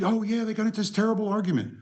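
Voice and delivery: high, breathless rush of shock; upset gushing. Retold or imitated. Assistant rushing back into the office, high and frantic. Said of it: "comes back to the office and is tears".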